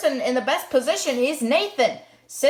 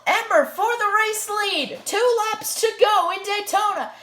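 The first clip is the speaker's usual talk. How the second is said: higher, urgent race call; motorsport commentator; 8 semitones higher than usual. Acted. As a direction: call the lead change like live TV. Race call, last laps.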